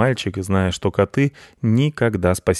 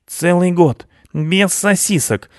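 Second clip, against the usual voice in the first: frightened; 10 semitones higher, slower than usual. Acted. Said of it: "испугался Рыжопиус".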